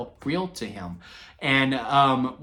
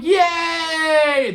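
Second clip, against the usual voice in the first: high, loud mock crowd cheer; 8 dB louder than usual. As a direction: belt it like a cheering arena. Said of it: "and we're going".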